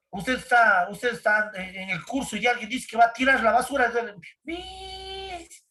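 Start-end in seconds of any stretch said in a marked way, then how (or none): 4.4-5.6 very high, soft squeaky call; a sudden high little shout-out; mock call. Someone in class says they'll take out the trash, very high soft call.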